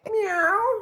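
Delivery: high playful meow through laughter; mock meow. Vlogger teasing the newly rich friend, laughing meow.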